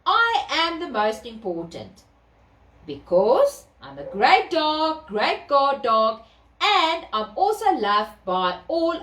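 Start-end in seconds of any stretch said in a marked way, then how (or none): none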